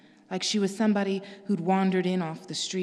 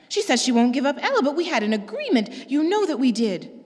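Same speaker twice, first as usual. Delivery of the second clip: higher, louder appealing sister; Pearl's voice; 5 semitones higher, 6 dB louder than usual. Acted. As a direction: appeal to August, pitched up.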